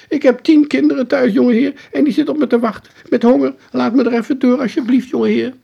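high, loud, begging working man, rushed in places; pleading painter. Acted. Trapped painter begs the boy, high and desperate. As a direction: beg the young master to let you go.

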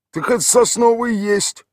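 high, put-on village voice; gruff local man answering a stranger; rough villager. A narrator's reading. Tolik answers the city stranger in a rough village voice.

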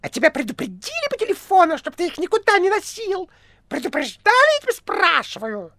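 very high, squeaky interrogation; piercing shriek. A narrator's reading. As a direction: screech like an angry cat. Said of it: "пронзительно прокричал похожий на кота". (пронзительный визгливый допрос)

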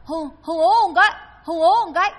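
loud, high plea with consonants lost; imitated stiff tongue. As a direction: struggle to speak like a dying man with a stiffened tongue. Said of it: "nói cự lưỡi nó cứng rồi".

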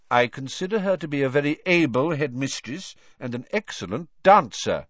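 ordinary delivery, nothing marked

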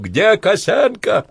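loud, high, drawn-out call; shouting. A narrator's reading. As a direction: shout for a missing soldier over gunfire. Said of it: "кричал Иванцов".

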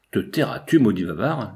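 usual speaking voice

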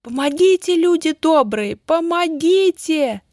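very high, loud cry for help; пронзительный визг. A narrator's reading. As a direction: shriek for help, drawing out the last word. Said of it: "раздался пронзительный визг". (пронзительный крик о помощи)

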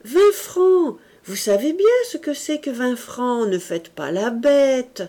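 high, loud scolding tease; teasing girl. A narrator's reading. Berthe chiding her uncle for playing dumb, high and loud. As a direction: chide him playfully. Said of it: "reprit Berthe".

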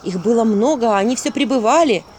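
plain reading voice

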